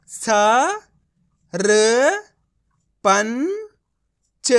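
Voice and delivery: teacher sounding out each letter slowly. Shown as a demonstration. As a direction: spell out स, र, पं, च one at a time with pauses. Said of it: "अब आपको मेरा तरीका देखना है". (हर अक्षर अलग-अलग धीरे बोलना)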